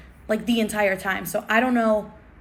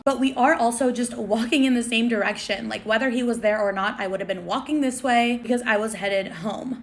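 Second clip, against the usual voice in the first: ordinary talk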